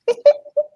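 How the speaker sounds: bright squealing laugh; high laugh. Real, not acted. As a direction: laugh out at the memory. Laughing at own story.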